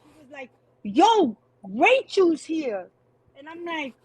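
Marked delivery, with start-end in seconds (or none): 0.8-2.9 hyped-up friend announcing an arrival, higher and drawn out; imitated excitement. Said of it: "she got mad excited".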